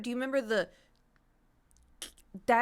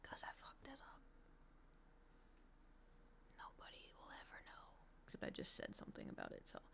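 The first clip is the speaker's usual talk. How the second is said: hushed, unvoiced mock-secret whisper; secretive whisper; 23 dB quieter than usual. Streamer confessing a botched makeup wing, secretive whisper. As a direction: whisper it like nobody should hear.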